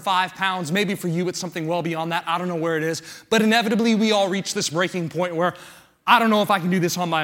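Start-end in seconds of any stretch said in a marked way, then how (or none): none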